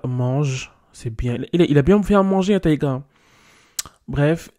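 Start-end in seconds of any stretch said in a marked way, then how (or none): 1.5-3.0 excited praise. Real, not acted. Praising Taïga loudly.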